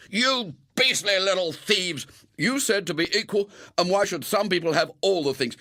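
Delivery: high, loud furious scolding; furious accusations. Acted. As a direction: boys yelling at the little thieves. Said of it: "accusations and justifications were hurled".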